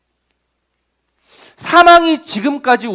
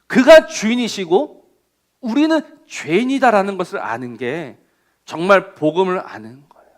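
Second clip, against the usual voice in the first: usual speaking voice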